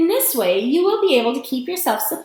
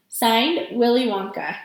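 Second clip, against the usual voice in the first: ordinary delivery, nothing marked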